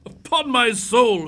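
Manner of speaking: chuckling through the words, slow; amused laugh breaking into speech; doctor laughing at his friend. Acted. Watson laughs at Holmes' severity.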